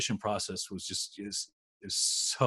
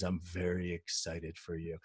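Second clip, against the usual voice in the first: slow, soft, low monotone; unexcited deadpan; 6 semitones lower, 10 dB quieter than usual. Retold or imitated. Agent congratulating client without any excitement, deadpan. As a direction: claim excitement with none in the voice. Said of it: "you don't sound like you're excited".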